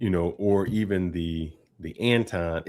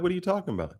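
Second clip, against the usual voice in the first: perplexed; 8 semitones higher, 5 dB quieter, much faster than usual. Retold or imitated. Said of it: "I'm perplexed".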